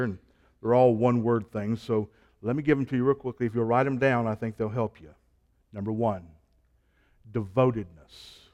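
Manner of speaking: ordinary talk, nothing marked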